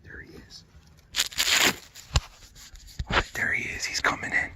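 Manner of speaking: hushed whisper near game; wildlife watching whisper. Real, not acted. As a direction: whisper so the bird won't hear. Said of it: "This is where we gotta be real quiet".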